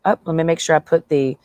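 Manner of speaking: normal talking voice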